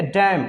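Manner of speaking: डैम ending on M; silent N. Shown as a demonstration. Teacher drilling learners, डैम without N. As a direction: say डैम stopping at M. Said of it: "क्या पढ़ेंगे इसको".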